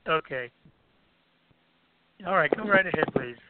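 ordinary delivery

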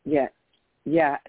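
ordinary talk

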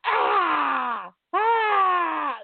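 high, loud, hammed-up pained scream; mock announcer scream. Bleeding announcer screaming at the camera, hammed-up yell. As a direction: ham up a bleeding man's yell. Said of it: "he's just screaming".

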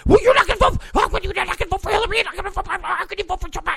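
high, rapid, incredulous mock tirade; outraged badgering. Berating an undecided voter over Hillary, outraged high badgering. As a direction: pile on questions in high-pitched indignation.

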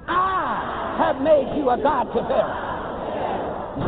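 "I have made you a God to Pharaoh" drawn out slowly for all to repeat; slow call and response. Shown as a demonstration. Preacher leading congregation in repeating, slow call and response. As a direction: lead the room word by word, "made" leaned on. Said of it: "Come on, say it with me".

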